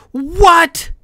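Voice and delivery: high, loud outraged roar. A narrator's reading. Kai hearing the competition continues, roaring. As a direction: roar it in disbelief. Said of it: "Kylos roared".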